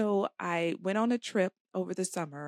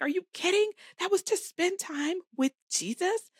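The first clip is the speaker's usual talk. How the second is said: high, louder burst of disbelief; retold outrage, pitched way up; incredulous; 12 semitones higher, 4 dB louder than usual. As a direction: exclaim it as if it were unthinkable. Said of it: "I was like".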